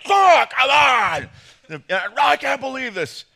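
high, loud exasperated shout; angry outburst. Acted. Pastor mimicking a golfer who shanked a shot, angry shout. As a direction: explode in acted sporting frustration.

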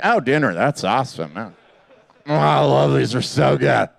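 low, lazy mock bear voice; contented bear. Bear delighted by the dinner bell, low pleased voice. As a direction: savour it like a bear offered dinner. Voicing bears hearing the bells.

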